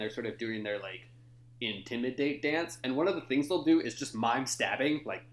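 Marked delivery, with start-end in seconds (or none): none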